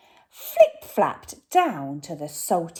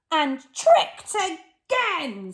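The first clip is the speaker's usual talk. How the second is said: higher, slow, glum robbers; 10 semitones higher, 5 dB louder, slower than usual. Acted. The two bad men admit defeat, slow and high. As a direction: moan it like beaten crooks. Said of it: "said the two bad men".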